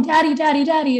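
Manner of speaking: put-on high excited child calling; little girl voice. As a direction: call out like an eager little girl. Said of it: "his little girl comes running up".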